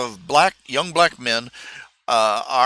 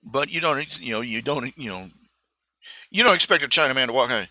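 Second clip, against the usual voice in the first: usual speaking voice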